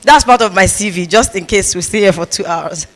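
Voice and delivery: ordinary talk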